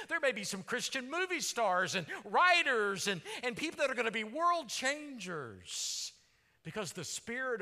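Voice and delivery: loud, fast, rising preacher's fervour; preaching at full force. Acted. Pastor stirring the young people, loud fervent preaching. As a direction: whip the room up with vision.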